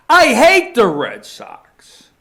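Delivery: loud, high, drawn-out declaration of hate; real heated sports-fan rant; emotional outburst. Real, not acted. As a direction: blurt it out slow and loud, pitch jumping up. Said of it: "I knew I would get emotional".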